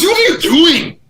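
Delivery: high, loud, drawn-out shout; hammed-up indignant holler; imitating angry teammate. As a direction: yell it in mock outrage. Retold teammate's angry outburst.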